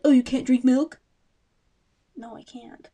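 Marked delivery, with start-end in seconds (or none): 0.0-1.0 loud, higher mock voice; loud mocking future employer. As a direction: ham up a snooty interviewer. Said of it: "They're gonna look at me and be like".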